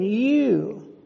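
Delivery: "you" set apart and drawn out; emphatic YOU. Retold or imitated. Preacher pointing out the verse's pronoun, emphatic "you". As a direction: lean on the word "you" as a pronoun. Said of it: "that's an emphatic pronoun".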